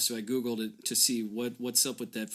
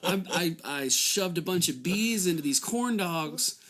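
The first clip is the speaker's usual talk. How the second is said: hammed-up sales pitch; 6 semitones higher than usual. Pitching a silly product.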